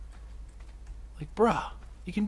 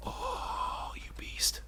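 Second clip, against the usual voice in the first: hushed whispered admiration; 17 dB quieter, much slower than usual. Real, not acted. Player whispering in awe at the beetle. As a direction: breathe it out in awe.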